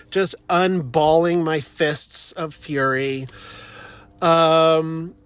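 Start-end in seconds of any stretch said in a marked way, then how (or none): none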